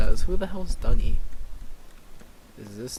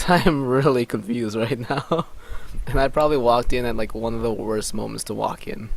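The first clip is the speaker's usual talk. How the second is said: ordinary delivery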